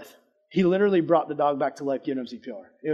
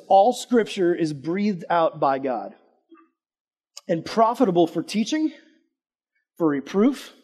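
slow, measured Bible reading; solemn reading of scripture to the group. Acted. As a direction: read the verse slowly and weightily. Reads the Bible verse aloud.

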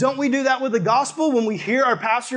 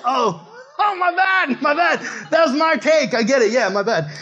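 high, embarrassed apology, louder; sheepish my bad; 6 semitones higher, 5 dB louder than usual. To his mother, caught out, a high sheepish my bad. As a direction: squeak out an apology after a goof. Realizing the cake was hers.

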